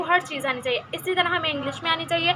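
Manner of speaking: normal talking voice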